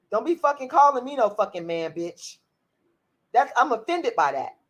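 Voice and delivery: normal talking voice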